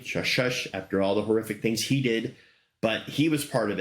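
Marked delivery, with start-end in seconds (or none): none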